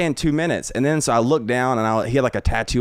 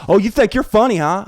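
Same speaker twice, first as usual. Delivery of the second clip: loud, higher-pitched imitated challenge; mock confrontational; 9 semitones higher, 5 dB louder than usual. Angry heckler confronting the comic after the show, mock confrontational. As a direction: square up as the offended heckler.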